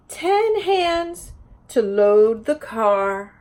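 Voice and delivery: slow, lilting picture-book read-aloud; sing-song story reading to a class; reading to children. Acted. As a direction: read each page like story time for little ones.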